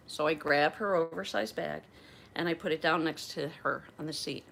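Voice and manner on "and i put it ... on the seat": normal talking voice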